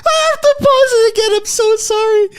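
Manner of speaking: high, slow, overexcited interruption; excited yelling. Real, not acted. Streamer interrupting the clip, overexcited, high and slow. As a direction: burst in to break the news. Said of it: "I'm sorry for screaming".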